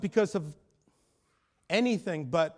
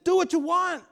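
high-pitched, insistent challenge; acted bold defiance; 10 semitones higher, 4 dB louder than usual. Father facing a threatening gang member, boldly defiant.

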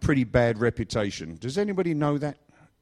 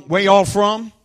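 higher, louder Alabama drawl; Alabama accent; 6 semitones higher, 8 dB louder, slower than usual. Retold or imitated. Driver quizzing the hitchhikers, in a mock Alabama accent. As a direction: put on a rough-and-ready Alabama accent. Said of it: "This accent is a Vaughnabama accent".

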